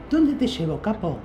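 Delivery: very high, thin taxi driver voice; squeaky high cabbie asking where to. Retold or imitated. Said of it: "con una voz finita".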